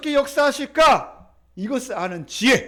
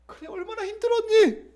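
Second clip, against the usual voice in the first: high, tender consoling voice; comforting father; 10 semitones higher than usual. Acted. God embraces the weary believer, high and sympathetic. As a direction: console a weary child, pitched well up.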